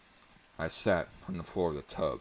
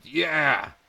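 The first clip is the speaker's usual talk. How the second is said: high, loud cry of triumph; triumphant scream; 11 semitones higher, 10 dB louder than usual. A narrator's reading. Boy cracking the code in the shower, loud yell. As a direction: yell it out in victory. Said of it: "and screamed".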